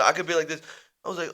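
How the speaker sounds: ordinary talk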